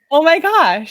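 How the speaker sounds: high, loud, amazed exclamation; overwhelmed joy. Retold or imitated. Coach reacting to client calling herself beautiful, overwhelmed joy. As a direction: burst out as if overcome.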